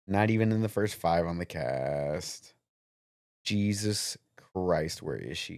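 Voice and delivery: half under his breath, hunting for a name; muttering to himself. Real, not acted. Muttering while searching for the name.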